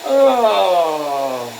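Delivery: ordinary talk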